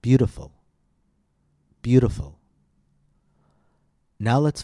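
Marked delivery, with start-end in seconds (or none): 0.0-2.4 "Beautiful" with a long, high first syllable BEAU and a light tap D for the T; stress on BEAU. Shown as a demonstration. Teacher models "Beautiful" for students, long high BEAU. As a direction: stretch and lift the BEAU of "Beautiful", then tap the T. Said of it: "hear the long vowel and the higher pitch".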